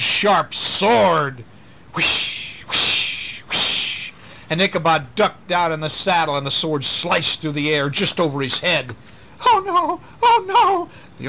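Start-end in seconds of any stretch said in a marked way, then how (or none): 1.9-4.1 voiceless whooshing sweeps; sword whoosh. Acted. Sword swinging through the air. 9.5-10.9 high, loud panicked cry; terrified cry. Acted. Sword slices over Ichabod's head.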